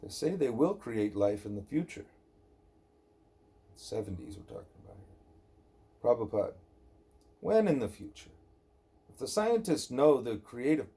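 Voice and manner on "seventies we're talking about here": whispered aside. Real, not acted.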